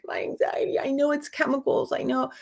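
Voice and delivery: imitating herself near tears, voice cracking upward; retold crying. Recalling her anxiety to viewers, a mild teary plea. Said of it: "I would be, like, crying".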